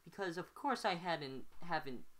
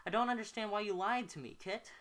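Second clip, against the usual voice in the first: accusatory; 7 semitones higher, 4 dB louder than usual. Acted. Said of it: "Now he sounds accusatory".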